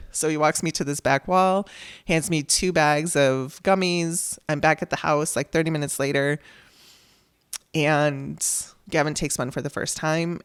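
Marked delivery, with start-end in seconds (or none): none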